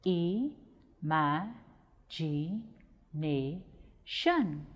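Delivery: "Imagination" extremely slowly, long gaps between all five syllables. Shown as a demonstration. Teacher repeating the syllables of "Imagination", very slow and separated. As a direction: stretch "Imagination" out, one syllable at a time. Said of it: "five syllables in the word imagination".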